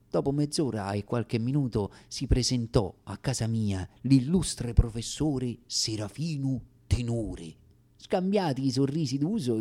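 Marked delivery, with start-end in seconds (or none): none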